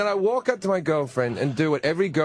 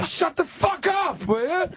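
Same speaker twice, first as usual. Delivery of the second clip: mock snapping; 11 semitones higher than usual. Telling drunk girlfriend to shut up, high mock yell. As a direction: yell it at her in disbelief. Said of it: "And I'm like".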